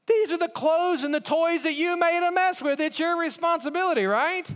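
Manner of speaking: high, exasperated parent lecturing; scolding parent. Retold or imitated. Parent scolding child over mess.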